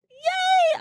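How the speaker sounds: a very high, bright cheer; excited yay. Real, not acted. Signing off to her listeners, a high excited cheer.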